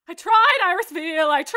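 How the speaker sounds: high, loud yelled line; shouting. Acted. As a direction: belt it out in frustration.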